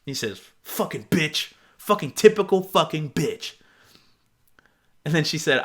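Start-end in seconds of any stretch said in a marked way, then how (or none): 0.6-3.5 imitating a bitter, rejected messager; hammed-up resentment, building in force; mock angry insult. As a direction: spit it out in mock anger.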